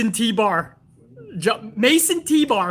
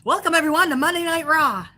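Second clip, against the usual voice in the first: high, limp mock commentator; sing-song mockery of a bland play-by-play voice; 7 semitones higher than usual. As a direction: send up a dull announcer, pitched way up. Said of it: "He's just like".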